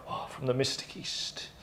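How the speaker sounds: breathy, hushed air of mystery; hammed-up soft mystique; mock Mystic East. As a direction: whisper it like an exotic advert. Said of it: "this is a bit like".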